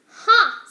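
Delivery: loud high barking ha; sharp mock laugh. Rat scoffing at servant girl's plan, loud scornful ha.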